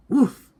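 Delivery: loud high woof; dog bark. A narrator's reading. Dog's line.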